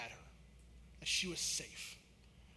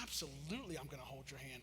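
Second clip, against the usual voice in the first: soft reassurance; 6 semitones lower, 12 dB quieter than usual. Retold or imitated. Dad reassuring scared daughter.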